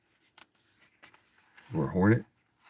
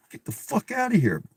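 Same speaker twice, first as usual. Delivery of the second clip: high-pitched get the fuck outta here; disbelief; 14 semitones higher than usual. Real, not acted. Reader hearing the AI woodsman twist, stunned disbelief. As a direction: squeal it out in disbelief. Incredulous reaction.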